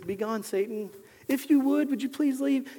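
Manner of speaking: overly polite, timid request; mock nice. Shown as a demonstration. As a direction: plead politely, rising in pitch. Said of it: "You don't have to be nice to Satan".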